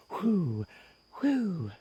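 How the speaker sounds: hammed-up panic breathing, high pitched; panicky whoo breaths. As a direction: huff out high, frightened breaths. Acting out panic attack.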